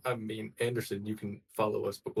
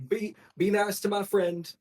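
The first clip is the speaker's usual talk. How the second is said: pleading husband; 9 semitones higher, slower than usual. Acted. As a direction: ask the crowd kindly but insistently. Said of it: "he's like".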